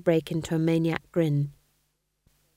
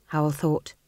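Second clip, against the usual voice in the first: plain reading voice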